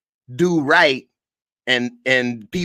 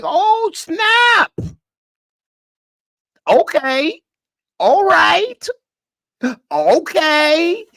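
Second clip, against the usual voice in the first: much higher, louder hammed-up voice; character impression; 5 dB louder, much slower than usual. Host acting out the show character's scene, high hammed-up impression. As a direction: play it big and startled, way up high. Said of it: "That's my impression".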